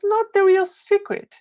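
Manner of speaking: rising emphatic denial; stress on not. Real, not acted. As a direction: lift and punch "not the real secret". Emphatic point in a speech.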